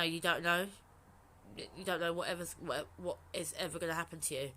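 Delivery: ordinary delivery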